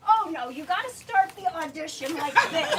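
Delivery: insistent coach. Retold or imitated. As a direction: push the actor playfully. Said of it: "Tim would say".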